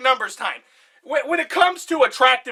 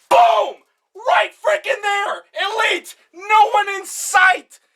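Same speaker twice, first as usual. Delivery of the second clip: excited shouting; 9 semitones higher, much slower than usual. Real, not acted. Fan crowing over the coach's hotness, excited shouting. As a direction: shout it like your team just scored. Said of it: "I just get really passionate and heated".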